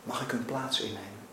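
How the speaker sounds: soft, low, humble request; quiet plea. A narrator's reading. Mackenzie offering himself for his children, quiet pleading. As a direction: ask it very softly, pleading. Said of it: "met smekende ogen".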